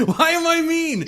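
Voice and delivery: high, loud incredulous protest; mock indignant. Real, not acted. Co-host answers being called mean, mock indignant.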